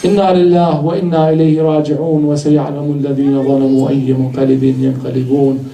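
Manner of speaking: fast, low, run-together closing recitation; rapid Arabic recitation. Acted. The preacher closing the majlis with a verse, fast recitation. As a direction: rattle off the closing verse quickly.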